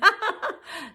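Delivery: a high burst of laughter; pleased laugh at the trick; real laugh. Real, not acted. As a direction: laugh at your own joke. Laughs at own joke.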